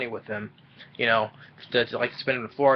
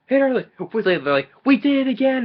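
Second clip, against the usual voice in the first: high, louder mock outcry; retold panicked exclamation; 12 semitones higher, 5 dB louder than usual. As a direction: imitate parents crying out, pitched way up. Parents' panicked cry retold from film.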